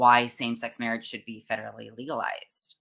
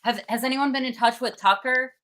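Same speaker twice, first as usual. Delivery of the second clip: raised, higher voice, taunting out loud; loud mocking taunt; 12 semitones higher than usual. Taunting protesters aloud as she is escorted out, loud mock questions. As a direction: heckle them loudly on purpose. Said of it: "I was, like, loudly saying".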